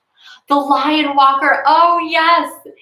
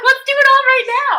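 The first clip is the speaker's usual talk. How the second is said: eager; 6 dB louder than usual. Retold or imitated. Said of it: "I'm just like".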